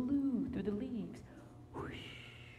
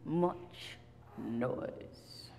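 loud grumpy Pah, then much noise dropped away; grumpy old man; 3 semitones lower, 11 dB louder, much slower than usual. Acted. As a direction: huff it out like a cranky old man. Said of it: "He said".

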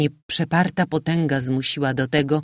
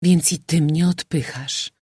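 plain reading voice

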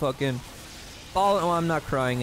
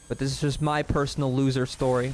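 ordinary talk

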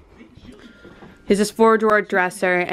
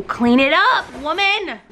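higher, hammed-up command; mock bossy order; 5 semitones higher than usual. Daughter teasing Mom about her cluttered seat, mock bossy order. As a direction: order them about in jest. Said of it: "Maybe that's our way of telling you".

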